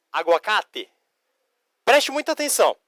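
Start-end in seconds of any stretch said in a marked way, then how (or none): 0.1-0.9 "aguacate" said the Spanish way, clear final "te"; Spanish pronunciation. Shown as a demonstration. Teacher giving students the Spanish for avocado, Spanish pronunciation. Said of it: "Em espanhol".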